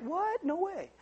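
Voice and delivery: high, soft incredulous squeak; tiny high-pitched mock astonishment; mock disbelief. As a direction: squeak it quietly in pretend shock. Mimics a disbelieving listener.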